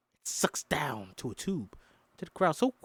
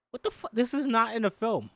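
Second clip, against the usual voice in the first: surprised outburst; 9 semitones higher than usual. Real, not acted. Reading the script, shocked at an unfamiliar scene. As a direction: blurt it out in disbelief.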